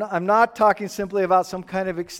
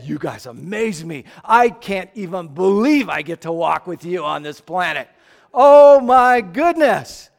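mock gushing praise; 8 dB louder than usual. Preacher mocking praise Jesus never gave the disciples.

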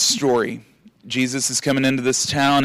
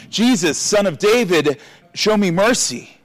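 a raised, higher cry for mercy; narrated shout; 9 semitones higher, 3 dB louder than usual. A narrator's reading. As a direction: call out to Jesus, pitch up. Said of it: "he began to shout".

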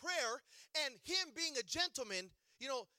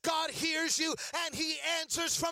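louder, rising sung-out sermon cadence; full-force preaching; 4 semitones higher, 6 dB louder than usual. Acted. Preacher building to climax before congregation, singing out.

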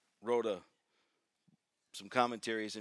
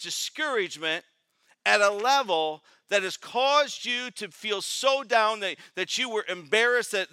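rising, raised voice of a preacher in full flow; fiery preaching; 8 semitones higher, 12 dB louder than usual. Acted. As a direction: preach it to the back row. Preacher builds to full force.

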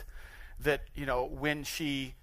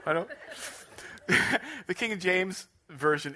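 usual speaking voice

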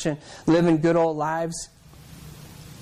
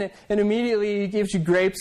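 ordinary talk, nothing marked

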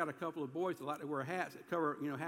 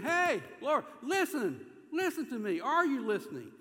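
loud, high, insistent cry to God; demanding; 8 semitones higher, 7 dB louder than usual. Acted. David demanding God's attention in prayer, demanding. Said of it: "he's demanding God to do something".

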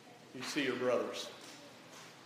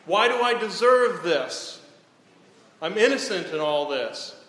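high, louder protest to God; pleading Job; 5 semitones higher, 5 dB louder than usual. Acted. Job protesting his innocence to God, high and pleading. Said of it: "He's questioning".

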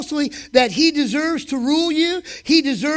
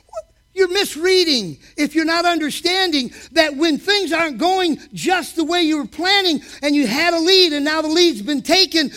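ordinary talk

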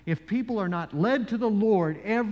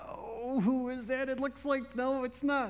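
puzzled musing; 5 semitones higher than usual. Retold or imitated. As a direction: wonder to yourself, trying to place someone. Retells puzzling over who it is.

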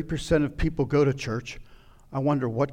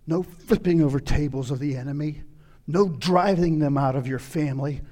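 normal talking voice

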